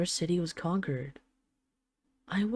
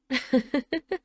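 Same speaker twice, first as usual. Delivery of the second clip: a light, higher teasing laugh; amused laugh; 7 semitones higher than usual. Acted. Dragon queen laughs at her glaring husband, teasing laugh. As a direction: laugh at his fierce glare.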